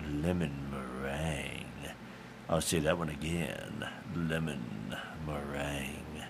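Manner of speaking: low, slow, savoring each pie; dreamy low voice. Pretend. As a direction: drool over every pie name. Savoring list of pies.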